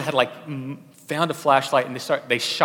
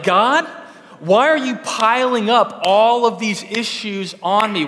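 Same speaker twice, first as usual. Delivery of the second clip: higher, louder, slow exasperated plea; hammed-up frustrated prayer; frustrated complaint; 8 semitones higher, 9 dB louder, slower than usual. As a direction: complain to God as if overwhelmed. Mock complaint to God.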